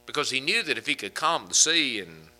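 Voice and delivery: ordinary talk, nothing marked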